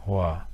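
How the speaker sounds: "Hoa" low and gently falling; grave tone. Shown as a demonstration. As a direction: let "Hoa" sink softly. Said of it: "c'est légèrement grave, ça se prononce".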